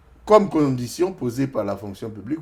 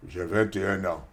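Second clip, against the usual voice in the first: a hint of a put-on deeper man's voice; faked manly voice; 4 semitones lower, 4 dB quieter than usual. Young man lying about his age to the clerk, faked manly voice. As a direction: try to sound older and manlier. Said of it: "Tu faisais tout pour prendre la grosse voix masculine".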